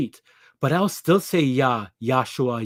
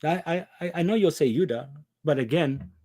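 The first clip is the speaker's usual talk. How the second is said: normal talking voice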